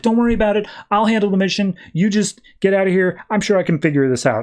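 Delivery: high-pitched plucky reassurance; eager kid friend. Retold or imitated. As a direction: imitate a keen young sidekick taking charge. Maps bravely takes over.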